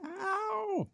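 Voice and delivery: tiny mouse yelping ow in a very high squeak; mouse squeal. Acted. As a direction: squeak it out like a hurt little mouse.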